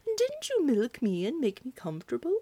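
lightly voiced cow, comforting; mild cow voice, reassuring; kindly cow. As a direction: reassure the girl as a gentle cow. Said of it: "answered the cow".

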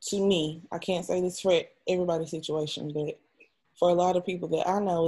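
ordinary talk, nothing marked

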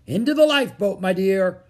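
high, loud directing voice; firm order. A narrator's reading. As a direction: order a child aboard briskly. Man firmly orders Annie aboard.

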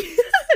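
burst of laughter. Real, not acted. Laughs at her own line.

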